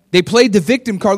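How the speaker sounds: normal talking voice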